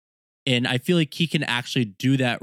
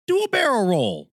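higher, louder game sidekick call; game character quote; 11 semitones higher, 5 dB louder than usual. Retold or imitated. Quoting a Star Fox trailer line.

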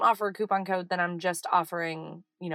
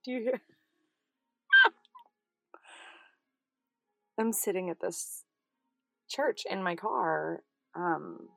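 normal talking voice

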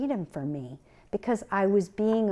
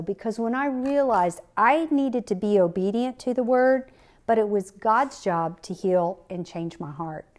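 ordinary talk, nothing marked

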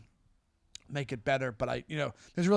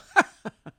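short, higher, louder mocking laugh; sarcastic laugh; 13 semitones higher, 7 dB louder than usual. Shown as a demonstration. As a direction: scoff at them with a fake laugh. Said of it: "that's what I do".

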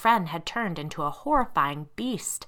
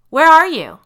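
loud, high searching shout; calling out; 11 semitones higher, 17 dB louder than usual. A narrator's reading. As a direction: parent shouting through the house. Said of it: "a voice called".

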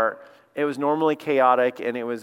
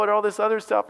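usual speaking voice

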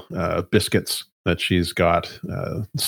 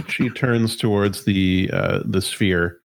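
ordinary talk

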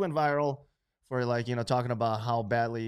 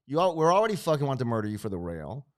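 normal talking voice

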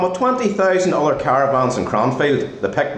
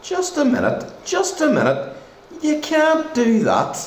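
high-pitched protest; 9 semitones higher than usual. Acted. Protests loudly, interrupting.

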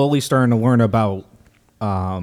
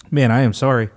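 ordinary talk

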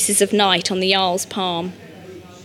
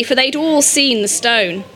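usual speaking voice

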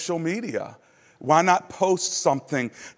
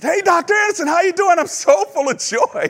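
much higher and louder, bursting with joy; joyful excited greeting; 5 dB louder than usual. Retold or imitated. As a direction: call out happily, full of new joy. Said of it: "your countenance sounds so different".

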